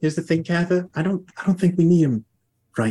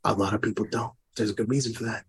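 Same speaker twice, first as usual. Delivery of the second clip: low, softened menace; quietly ominous, pitched well down; threatening accent; 5 semitones lower, 8 dB quieter than usual. Acted. Interrogator answers the prisoner's dislike, low quiet threat. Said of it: "this is my threatening accent".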